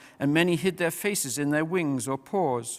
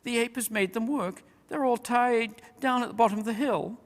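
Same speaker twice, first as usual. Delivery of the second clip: much higher tiny mouse voice; squeaky mouse voice; 9 semitones higher than usual. A narrator's reading. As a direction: chatter like a little mouse. Mice report to king.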